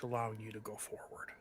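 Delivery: faint eerie whisper. Acted. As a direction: breathe it out, spooky. GM eerie narration.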